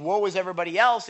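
lightly voiced prophet; mock prophet. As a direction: toss off the prophet's woe quickly. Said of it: "he's the one that was saying".

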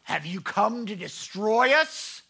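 a hint of an alarmed demon; demons confronting Jesus. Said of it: "These demons are like".

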